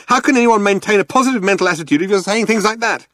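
high, fast and agitated; frantic protest. A narrator's reading. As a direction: rattle it off in exasperation. Ford frantically protests while being dragged.

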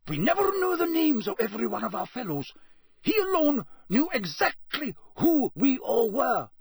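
high, hasty pleading; hurried, nervous. A narrator's reading. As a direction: rush to explain. Karkaroff pleading before court.

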